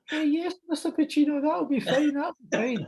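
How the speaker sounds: comic very high-pitched squeak; squeaky starstruck agreement. Retold or imitated. Host agreeing to the star's request, squeaky mock falsetto. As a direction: squeal your eager yes in mock falsetto. Mocks own starstruck reply to Pacino.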